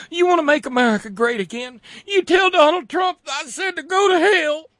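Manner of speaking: high, fluttery mock southern belle, building to a shriek; prissy Lindsay. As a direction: squeal it out like a scandalized fainting lady. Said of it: "as soon as he got over having the vapors".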